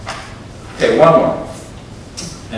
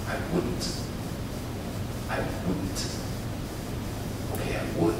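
whispering; 11 dB quieter than usual. Acted. Whispered ending of poem.

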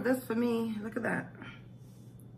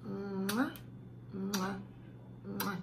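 soft kiss sounds, each one quieter; blown kisses; 10 dB quieter, much slower than usual. Real, not acted. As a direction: blow three kisses to the camera. Blowing kisses goodbye to viewers.

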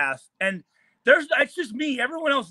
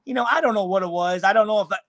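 ordinary talk

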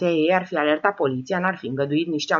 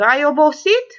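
louder, mocking sarcastic jab; 8 semitones higher, 8 dB louder than usual. Old woman mocks tired George, sarcastic and sharp. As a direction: needle him with mock concern. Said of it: "l-apostrofă sarcastică".

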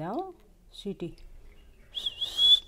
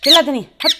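much louder shout to stop the noise; angry shout; 3 semitones higher, 14 dB louder, much faster than usual. Real, not acted. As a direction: yell at the bird to shut up. Shouting at the screeching parrot. (तोते पर ज़ोर से चिल्लाना)

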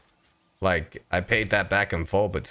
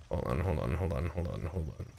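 muttered to self; 4 semitones lower, 9 dB quieter than usual. Real, not acted. Muttering while spotting letters.

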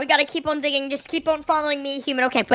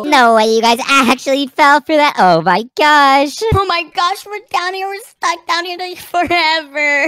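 gloating villain; 7 dB louder than usual. Acted. Mother gloats after trapping the kids, loud and mocking. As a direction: crow over them falling for it. Prime Woman gloating over trap.

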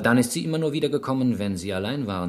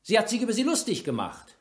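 higher, pressing accusation; accusing; 7 semitones higher than usual. Acted. Inspector accuses the suspect of the killing, raised and pressing. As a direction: hammer the accusation home.

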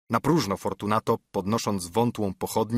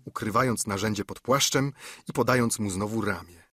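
ordinary delivery, nothing marked

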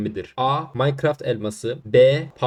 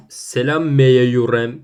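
mocking slow read; 9 dB louder, much slower than usual. Shown as a demonstration. Mock reading misspelled comment.

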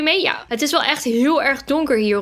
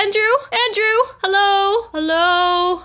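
higher calls into the empty house; calling out; 6 semitones higher, slower than usual. Real, not acted. Locked inside, she calls after Andrew, higher anxious calls. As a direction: call for a lost friend.

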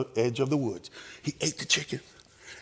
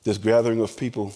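closing prayer; 5 semitones lower than usual. Real, not acted. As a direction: lead the room in prayer.

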